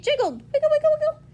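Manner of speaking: high, loud, bouncy sound word; playful wiggling. A narrator's reading. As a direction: make the word jiggle up high.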